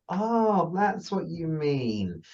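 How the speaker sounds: high then slow, soft realisation; sudden understanding; dawning realisation. Retold or imitated. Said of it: "make people go".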